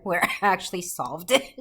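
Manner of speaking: ordinary talk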